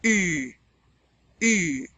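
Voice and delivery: "U" as ee through rounded lips; French U. Shown as a demonstration. Teacher demonstrates the vowel, long rounded "U". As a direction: say ee with lips set for oo to make "U". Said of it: "we're gonna round our lips".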